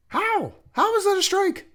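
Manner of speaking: very high-pitched disbelief; incredulous question. Real, not acted. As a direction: demand an answer in an astonished squeal. Incredulous at the strike call.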